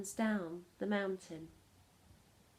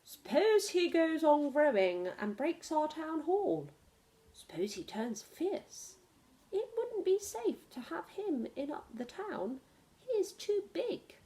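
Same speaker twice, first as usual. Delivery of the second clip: worried mayor; 6 semitones higher than usual. Acted. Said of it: "The mayor said".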